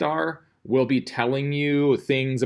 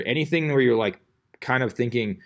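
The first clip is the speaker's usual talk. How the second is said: ordinary delivery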